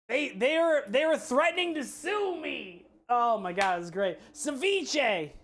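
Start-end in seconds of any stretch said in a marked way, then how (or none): none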